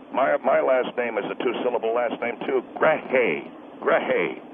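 low, gruff angry husband. Acted. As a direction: growl it like a protective husband. Husband gives his name.

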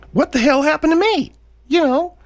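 very high, pinched squeak; squeaky voice. Acted. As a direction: squeak it out like a cartoon. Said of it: "that squeaky voice".

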